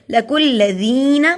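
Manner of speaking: "لَقُوا الَّذِينَ" with the ii held long; elongated ii. Shown as a demonstration. Teacher explaining elongation, "الَّذِينَ" with a long ii. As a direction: stretch the "ذِي" of "الَّذِينَ" for a full beat. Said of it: "زی کو اس لیے لمبا کیا".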